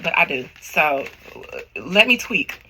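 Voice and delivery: ordinary talk, nothing marked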